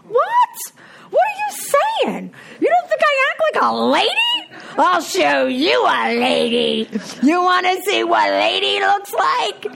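high, loud offended comeback; indignant outrage. Retold or imitated. Wife snapping back at husband who doubts she's ladylike, high and loud. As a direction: throw it back at him, insulted.

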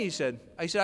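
ordinary delivery

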